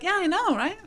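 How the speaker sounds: high-pitched, offhand agreement; breezy acknowledgement. Acted. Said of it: "Usually just go like".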